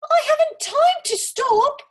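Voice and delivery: high, loud hurried cry; cried out. A narrator's reading. Olga crying out to the squirrel, loud and hurried. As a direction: call back in a rush. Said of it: "cried Olga".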